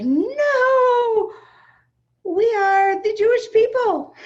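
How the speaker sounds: high, loud frantic protest; panicked outburst. Retold or imitated. Mother at her child's wedding, panicking at rude guests. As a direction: burst out in alarmed indignation. Retold panic at police, yelling.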